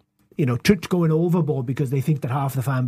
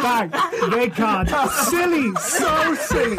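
high, laughing so silly; mocking the pundit; 8 semitones higher, much slower than usual. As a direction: squeal it through laughter. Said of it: "with him going".

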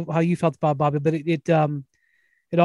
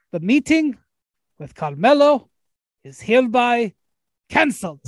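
bad Russian accent; 5 semitones higher than usual. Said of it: "I will do a bad Russian accent".

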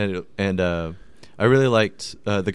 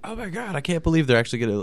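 excited; 8 semitones higher, faster than usual. Retold or imitated. Retold childhood excitement.

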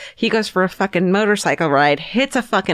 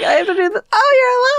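very high mock squeal; hammed-up squeal of relief at finding him alive; overjoyed wife; much faster than usual. Acts out grieving wife finding husband.

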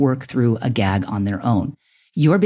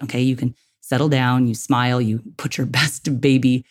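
usual speaking voice

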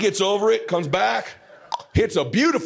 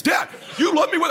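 put-on high-pitched child's outburst; startled kid imitation; 12 semitones higher than usual. Scared son answering his dad, high startled imitation. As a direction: mimic a scared boy, much higher.

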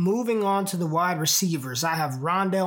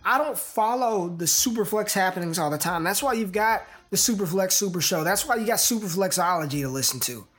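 upbeat pitch to listeners; promo read; 3 semitones higher than usual. Acted. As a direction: sell the show with energy. Promo pitch over music.

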